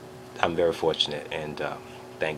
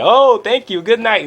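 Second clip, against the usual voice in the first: much higher and louder, teasing dismissal; 11 dB louder than usual. Retold or imitated. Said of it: "looks at me and is like".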